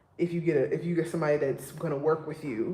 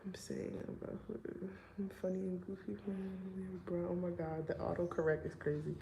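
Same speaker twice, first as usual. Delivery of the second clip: much softer, near whisper, reading chat; quiet aside; 14 dB quieter than usual. Real, not acted. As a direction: drop it to a murmur to yourself. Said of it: "reading chat aside".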